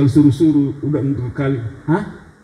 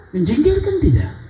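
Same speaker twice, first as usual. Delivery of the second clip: normal talking voice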